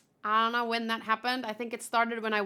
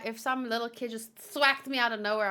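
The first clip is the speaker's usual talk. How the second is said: usual speaking voice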